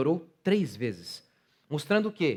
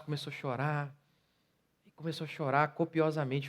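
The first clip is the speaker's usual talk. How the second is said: usual speaking voice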